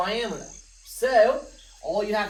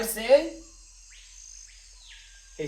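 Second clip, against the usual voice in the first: ordinary talk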